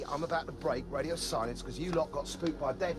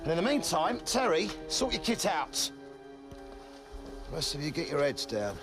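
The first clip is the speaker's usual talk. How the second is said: barking orders; 8 semitones higher, 4 dB louder than usual. Acted. Sergeant issuing orders.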